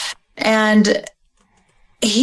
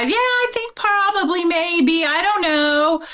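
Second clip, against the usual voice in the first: hedging mother; 10 semitones higher than usual. Retold or imitated. Mother answering the FBI, evasive and hedging. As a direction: trail off, noncommittal and evasive. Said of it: "when she's talking to the F B I".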